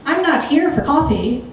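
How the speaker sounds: put-on a sharp American accent, snapped out. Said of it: "snapping in an American accent".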